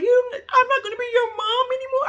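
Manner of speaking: mock crying mother. Retelling a TV mom's outburst to viewers, high mock crying. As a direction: imitate the mother sobbing out her threat. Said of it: "So her mother's crying".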